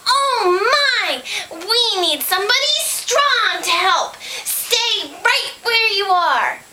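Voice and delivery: high, drawn-out worried pig voice; high-pitched storybook pig taking charge; alarmed pig. A narrator's reading. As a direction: fret dramatically, stretching key words.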